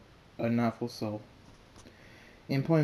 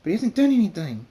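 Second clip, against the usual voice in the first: high, loud plea; desperate protest; 10 semitones higher, 10 dB louder than usual. A narrator's reading. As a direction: cry out in his defence. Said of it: "Twilight says".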